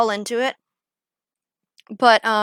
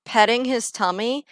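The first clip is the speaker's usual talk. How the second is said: ordinary talk, nothing marked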